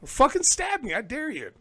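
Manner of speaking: loud. Pretend. Tough-guy dare, loud start.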